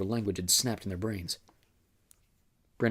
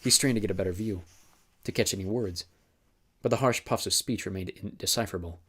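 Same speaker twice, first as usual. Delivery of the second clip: ordinary delivery, nothing marked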